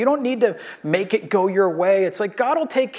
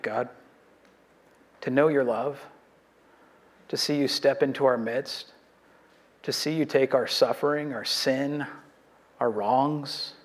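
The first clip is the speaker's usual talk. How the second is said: hushed, low prayer voice, slowing on key words; quiet earnest pastoral prayer; closing prayer; 4 semitones lower, 3 dB quieter than usual. Acted. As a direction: pray it softly to the congregation, head bowed.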